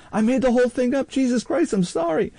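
high, panicked whining pleas; panicked punk. Retold or imitated. Punk dragged by the ear begs captain, panicked and high. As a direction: squeal it out like a terrified kid caught. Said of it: "And the guy goes".

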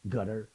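"gutter" softly, hard G; "gutter" quietly, stress up front; sounds like gutter. Shown as a demonstration. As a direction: say "gutter" as GUT-er. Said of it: "It sounds like gutter".

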